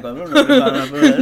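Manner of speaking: high, loud, fast laughing denial; mock innocent. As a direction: play dumb through laughter. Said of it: "And Eddie's like".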